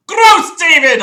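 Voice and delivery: loud grossed-out shout; disgusted yell. Acted. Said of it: "I yelled".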